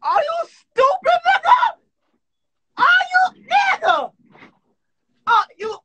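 high, drawn-out mock scream; outraged screaming. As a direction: wail it loudly in exaggerated disbelief. Mock outrage at friend's remark.

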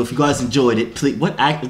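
usual speaking voice

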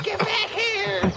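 very high, drawn-out mock yell; imitated chasing yell. Storyteller imitating Sydney chasing the dog, high stretched-out shout. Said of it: "And Sydney's like".

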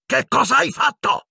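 shrieked, very high-pitched accusation; furious shout. A narrator's reading. Professor accusing the boy, furious shriek. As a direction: scream the question in a rage. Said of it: "gridò furibondo".

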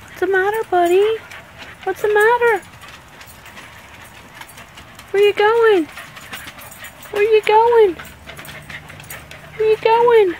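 high, sing-song coaxing to the dog; pet talk. Real, not acted. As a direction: coo at the worried puppy, high up. Talking to the upset dog.

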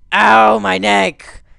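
slow loud mock howl; pained yell. Gamer howling as his ragdoll breaks its neck. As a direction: drag out the ow.

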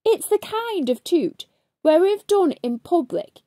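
ordinary delivery

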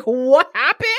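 loud mock-angry mom demanding answers; pissed-off mom. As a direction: snap it loudly, like an irate mother. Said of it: "his mom is pissed".

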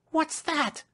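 very high, startled question; fearful cry. Acted. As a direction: cry out in sudden fright, pitch leaping. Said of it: "she cried fearfully".

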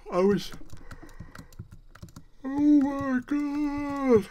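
very high, loud, long-held notes; high drawn-out exclamation; mock wail. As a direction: stretch it out in a high put-on howl. Mock yawning wail while playing.

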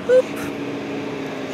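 usual speaking voice

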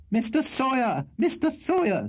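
very high-pitched screamed summons; screaming. A narrator's reading. Landlady calling her lodger from the two-pair landing, screaming. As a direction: scream the name from the landing above. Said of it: "screamed a voice".

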